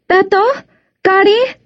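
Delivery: high calling voice. A narrator's reading. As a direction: call out to the kids across the river. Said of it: "she called".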